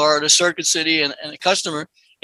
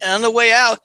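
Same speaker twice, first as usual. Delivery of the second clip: normal talking voice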